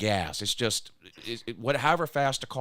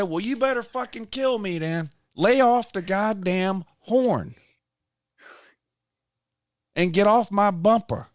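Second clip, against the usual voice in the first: loud, slowing on the horn line; retold yelling; 8 semitones higher, 7 dB louder than usual. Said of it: "I'm yelling at her".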